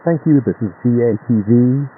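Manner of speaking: normal talking voice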